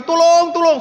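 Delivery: high, loud cry for help; acted shouting. Man hanging over a ravine calls for rescue, acted shouting. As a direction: yell for rescue, pitched high. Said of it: "Sambil teriak-teriak".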